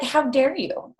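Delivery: lightly voiced how dare you; mock indignation. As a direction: play it mildly outraged, not a real fight. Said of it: "I'm like".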